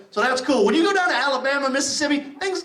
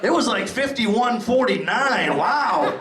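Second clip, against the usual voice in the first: Saint Peter; slower than usual. Retold or imitated. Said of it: "Saint Peter sitting there looking at me going".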